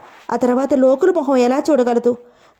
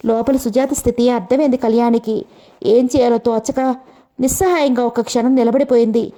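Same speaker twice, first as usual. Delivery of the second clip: usual speaking voice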